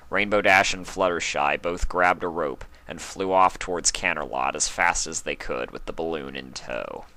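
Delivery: ordinary delivery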